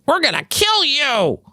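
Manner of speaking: very high, drawn-out falsetto threat; hammed-up screechy caricature of an empty threat; slow mock shriek; mock drunk girlfriend. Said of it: "really is the drunk girlfriend".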